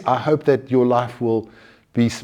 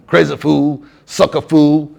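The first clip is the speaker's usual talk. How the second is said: loud, berating tough-guy impression; Mister T; 3 semitones higher, 5 dB louder than usual. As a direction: put on a TV tough guy scolding fools. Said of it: "like Mister T from A-Team".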